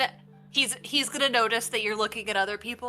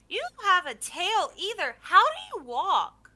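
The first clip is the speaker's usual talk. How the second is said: put-on child voice; 8 semitones higher, 4 dB louder than usual. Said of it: "He goes".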